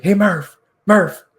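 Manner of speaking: high, loud shout for a teammate; hammed-up yelling across the bench; imitated yell. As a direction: holler the name to get his attention. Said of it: "like, yelling at Connor Murphy".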